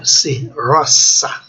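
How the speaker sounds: roça very slow with hissed s; "roça" drawn out, s kept unvoiced; voiceless s, not z. Shown as a demonstration. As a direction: say "C", then "roça" as RROS-sa. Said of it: "não Z".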